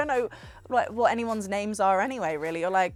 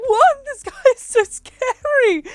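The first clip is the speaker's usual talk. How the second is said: very high, loud mock wail; crying in fright; 13 dB louder, slower than usual. Retelling fleeing a creepy fan with a friend, mock crying. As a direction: wail it high, dragging out the end. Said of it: "I was crying".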